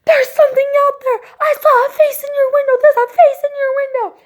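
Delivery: very high, squealing panic; sustained high mock scream; imitated terrified shriek. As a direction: mimic a frightened cousin in a high-pitched wail. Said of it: "And she screams".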